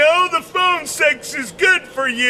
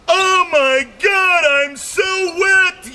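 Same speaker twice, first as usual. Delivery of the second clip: mock aroused cry; 7 semitones higher, slower than usual. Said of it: "They're thinking".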